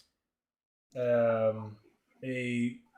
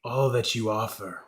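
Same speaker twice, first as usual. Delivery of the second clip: slow, weighty creature voice; accepting creature god; 5 dB louder than usual. Acted. God accepts the player's offering, slow and weighty. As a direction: pronounce it slowly as a god. Game master answers as the god.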